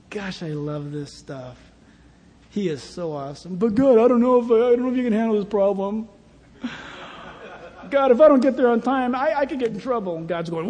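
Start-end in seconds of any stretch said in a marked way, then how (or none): 3.6-10.2 mildly anxious rush, louder; fretting believer. Acted. Worried believer pleading with God, lightly hurried. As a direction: fret to God, hurrying the words.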